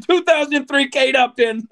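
repeating it through laughter; laughing. Real, not acted. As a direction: say it again laughing. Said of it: "repeated laughing".

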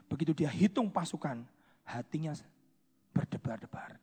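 hushed, breathy telling; whisper. Acted. Preacher telling of David's pounding heart, whispered. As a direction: drop to a whisper for the guilt.

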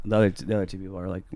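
ordinary talk, nothing marked

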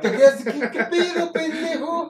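laughing impression of ñero slang; mock ñero voice. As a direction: parody a ñero talking tough while laughing.

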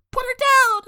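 quick hammed-up "Put her down!" yell; mock shout. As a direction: yell it like a kid hero. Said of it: "then Ryan's like".

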